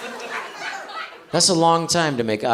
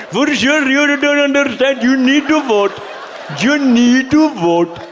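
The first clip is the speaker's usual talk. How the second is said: higher, hammed-up accent, rising and pleading; mock shop accent; 11 semitones higher, 4 dB louder than usual. Kamala urging a shop clerk to vote, hammed-up accent. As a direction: do Kamala at the seven eleven. Continues the seven eleven impression.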